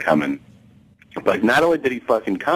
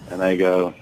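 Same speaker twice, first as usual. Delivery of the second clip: ordinary talk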